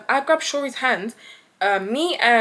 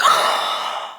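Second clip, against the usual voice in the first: big acted gasp of shock. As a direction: gasp as if a friend just fell. Reacts to friend falling.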